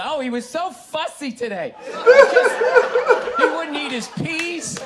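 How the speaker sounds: high, mock-fussing parent voice; mock complaining parent. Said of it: "talk to me about a toddler".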